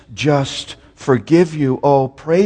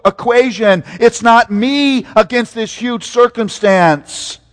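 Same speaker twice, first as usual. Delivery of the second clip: loud, high preaching build; rising pulpit fervour, loud and high; full-force preaching; 8 semitones higher, 4 dB louder than usual. Real, not acted. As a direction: lift it up and preach it out.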